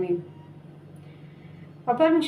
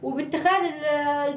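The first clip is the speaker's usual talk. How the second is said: normal talking voice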